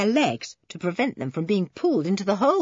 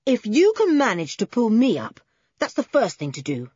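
plain reading voice

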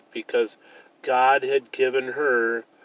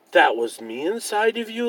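wondering; 8 semitones higher than usual. A narrator's reading. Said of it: "asked in wonder".

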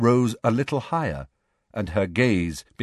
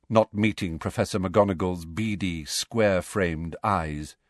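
plain reading voice

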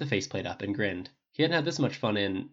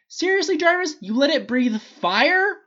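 high, loud, slow disbelief; indignant complaint; outraged; 9 dB louder, slower than usual. A narrator's reading. Tony outraged at AI.

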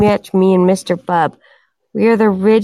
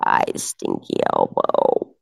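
much lower, slow, croaking rasp; croaky deep voice; 8 dB quieter than usual. Acted. To the chat, hamming up a lost voice in a deep slow croak. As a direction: let the voice sink into a dried-out croak. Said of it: "I sound like a man".

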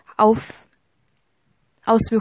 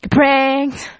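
excited; 4 semitones higher, 4 dB louder than usual. Real, not acted. Reveals the prank joke.

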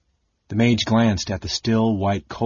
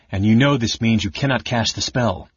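plain reading voice

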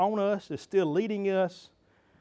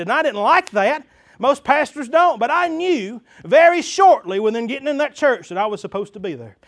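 loud, high, impassioned sermon; full-force preaching; 5 semitones higher, 9 dB louder than usual. Real, not acted. Pastor testifying he knew his calling, full-force preaching. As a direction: drive each phrase out to the whole congregation.

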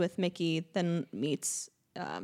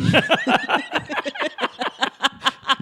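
loud, high laughing fit; high burst of laughter; 5 dB louder than usual. Real, not acted. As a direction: crack up at the slip. Laughing at peeing slip.

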